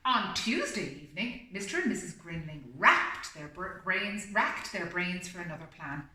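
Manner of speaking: steady storybook reading voice; story narration. A narrator's reading. Reading the story aloud to a listening pet, storybook voice. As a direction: read it like a bedtime picture book.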